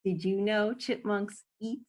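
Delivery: ordinary delivery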